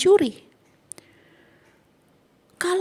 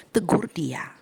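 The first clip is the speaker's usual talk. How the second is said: whispered; 5 semitones lower, much slower than usual. Shown as a demonstration. Preacher urging the congregation to rebuke in private, whispered. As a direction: say it as a private word.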